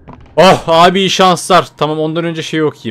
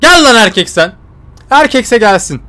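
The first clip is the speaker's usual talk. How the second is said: loud higher challenge; mock bravado; 6 semitones higher, 5 dB louder, slower than usual. Real, not acted. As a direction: taunt the monster to come. Taunting the monster. (canavara meydan okuyan yüksek sesli kabadayılık)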